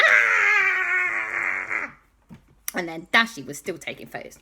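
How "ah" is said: very high, long scream of pain; Balthazar Brat. Acted. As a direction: shriek as if bitten. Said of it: "Balthazar Brat went".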